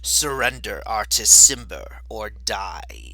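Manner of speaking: loud booming command. Acted. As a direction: boom it out across the square. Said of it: "You hear a booming voice".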